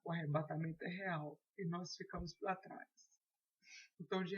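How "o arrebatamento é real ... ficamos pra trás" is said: ordinary delivery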